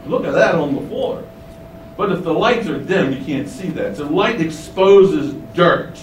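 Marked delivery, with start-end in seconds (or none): 0.1-1.2 whispered gossiping visitor. Pretend. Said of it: "They'll say".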